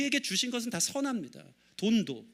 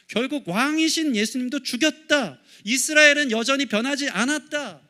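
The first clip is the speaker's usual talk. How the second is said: loud, emphatic sermon delivery; forceful preaching; 4 semitones higher, 5 dB louder than usual. Acted. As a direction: drive each verdict home to the congregation. (힘주어 외치는 설교)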